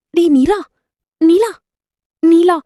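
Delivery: loud, high calls of a name; shouted calling. Acted. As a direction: shout after someone to make them turn back. Calling out after Neela.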